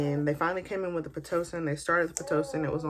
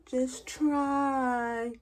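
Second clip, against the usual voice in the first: high far mock nurse voice; mimicking the nurse, high and dismissive; 8 semitones higher than usual. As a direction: parody the nurse's dismissive line. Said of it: "I never forget that".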